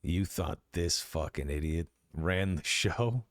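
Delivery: sneering mockery turning threat; mock them then threaten; menacing thug voice; scornful guard.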